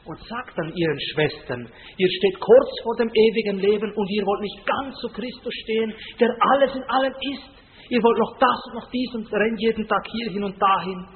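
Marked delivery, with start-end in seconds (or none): 2.0-11.1 higher, louder imitation of a pleading aunt; lightly voiced elderly aunt urging her sisters; Florentina pleading. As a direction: plead earnestly with her sisters, a hint of tears. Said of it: "fängt sie an zu weinen und sagt".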